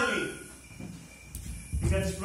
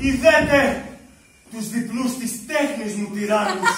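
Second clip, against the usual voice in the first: grand, slow tragic declamation of Orestes; tragic monologue; 4 dB louder, slower than usual. Acted. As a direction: declaim it like an ancient tragedy hero. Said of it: "θα παίξω το μονόλογο του Ορέστη". (τραγικός μονόλογος, αργά και στομφώδικα)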